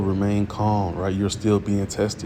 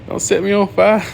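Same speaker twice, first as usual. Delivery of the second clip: much higher, frantic mock pleading with a laugh; panicked son; 11 semitones higher, 4 dB louder than usual. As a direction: play the boy freaking out on the altar, half laughing. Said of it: "he was probably freaking out, like".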